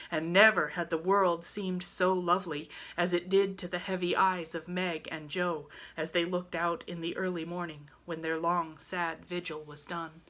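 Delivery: ordinary delivery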